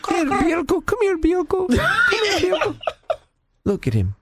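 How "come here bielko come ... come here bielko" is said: high sing-song pigeon calling; high cooing call. Retold or imitated. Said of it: "She's down there like".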